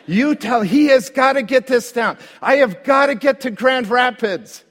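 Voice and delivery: loud, high, desperate yelling at the counter; frantic shout. Retold or imitated. Pastor shouting at the airport worker, panicked and loud. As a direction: shout it like you're missing your flight.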